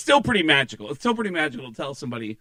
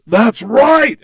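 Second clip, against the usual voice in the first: loud, high, triumphant confirmation; 9 semitones higher, 13 dB louder than usual. Retold or imitated. Rock star approving the assistant's answer, triumphant. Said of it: "clearly you're [name]".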